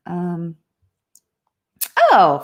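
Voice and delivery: ordinary delivery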